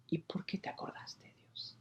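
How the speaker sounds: hushed whisper to God. Acted. Preacher whispering his wonder at God's mercy, hushed. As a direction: breathe the question, barely voiced.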